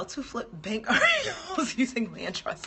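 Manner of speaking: laughing through the corrected line. Real, not acted. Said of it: "Let's go back".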